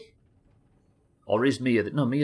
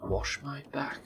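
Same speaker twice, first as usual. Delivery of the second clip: lightly voiced dwarf, pitched up; hint of a mock dwarf voice; imitating game dwarves; 6 semitones higher, 6 dB quieter, slower than usual. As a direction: mimic a dwarf's garbled line, a little higher and softer. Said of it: "what the dwarves sound like they're saying".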